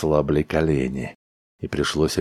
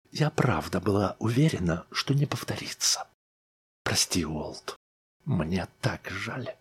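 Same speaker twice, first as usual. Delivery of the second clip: quiet apology; 3 semitones higher, 6 dB quieter than usual. A narrator's reading. Wife apologizes softly.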